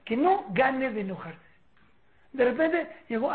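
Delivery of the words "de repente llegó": ordinary talk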